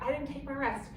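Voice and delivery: ordinary talk, nothing marked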